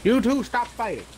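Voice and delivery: high stern wizard command. Retold or imitated. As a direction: bark it like a cranky old wizard. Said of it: "he was like".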